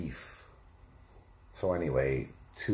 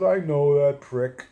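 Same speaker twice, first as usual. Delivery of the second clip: loud, high put-on voice; retold companion; 8 semitones higher, 10 dB louder than usual. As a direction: imitate a sidekick's loud retort. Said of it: "He goes".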